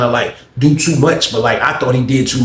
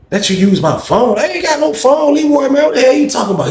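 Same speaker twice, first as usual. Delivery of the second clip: high, squeaky mock disbelief; incredulous denial; 13 semitones higher than usual. Asked by Leroy for the phone, high incredulous denial. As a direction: shoot the pitch way up, protesting innocence.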